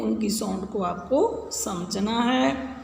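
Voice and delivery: normal talking voice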